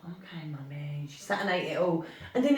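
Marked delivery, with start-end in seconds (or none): 0.0-1.1 imitating a little girl, much softer and slow; a small compliant child's reply, hushed; retold meek daughter, soft and slow.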